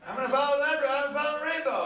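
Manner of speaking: high, breathless, giddy voice. Retold or imitated. Comic mimicking a man giddy about a rainbow, high and excited. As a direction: squeal it like a giddy fan.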